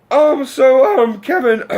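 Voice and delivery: high, loud nervous stammer; voice cracking. Retold or imitated. Nervous fan addresses his hero on the mic, voice cracking. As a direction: imitate a starstruck fan whose voice cracks. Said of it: "my voice is cracking".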